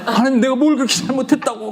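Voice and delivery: high, hurt whine at God; the preacher mimics himself whining to God; sulky whine. Preacher imitating his younger self, whining high to God. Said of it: "그때 내가 어땠는지 아세요?".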